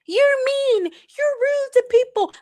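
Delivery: high mock imitation of a complaining follower; mock whiny critic. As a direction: parrot the critics in a silly high voice. Critics quoted mockingly.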